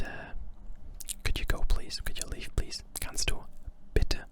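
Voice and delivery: ordinary delivery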